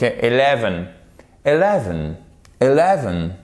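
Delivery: Eleven with a clear short E at the start, British style; British English. Shown as a demonstration. Teacher drilling British pronunciation for French learners. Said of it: "Ça, c'est anglais britannique".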